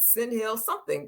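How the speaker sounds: normal talking voice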